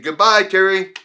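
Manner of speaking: high, loud, smug send-off; gloating goodbye. Retold or imitated. Taunting his rival while walking off to first class, loud smug farewell. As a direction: rub it in as you leave.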